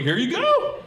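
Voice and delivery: high, proud sing-out; eager announcement. Acted. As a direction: pipe it up high, expecting a reward. Presenting himself eagerly to girlfriend.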